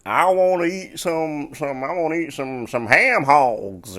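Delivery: hammed-up country accent, stretched out; mocking a Southern voice for laughs. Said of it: "It's never anything intelligent".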